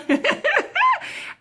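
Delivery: a high burst of happy laughter; real happy laugh at a viewer arriving; delighted laugh. Real, not acted. Viewer Mina joins, she laughs.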